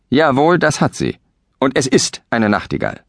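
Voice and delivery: stubborn retort. Acted. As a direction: snap back, won't give in. Quarrel over the bird.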